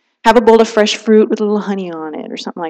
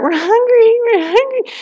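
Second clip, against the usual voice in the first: put-on much higher, nagging child voice; whiny kids; 13 semitones higher than usual. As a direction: squeal it like a tired kid in the cart. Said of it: "your kids are yelling at you".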